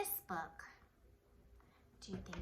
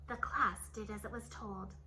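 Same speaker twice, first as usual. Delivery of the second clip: plain reading voice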